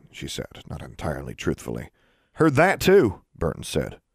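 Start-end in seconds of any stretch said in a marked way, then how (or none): 2.4-3.2 Burton's voice. A narrator's reading.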